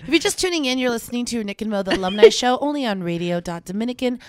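upbeat radio announcer; radio host voice. Acted. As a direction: read it like a station ID. Radio station ID.

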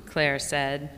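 ordinary delivery, nothing marked

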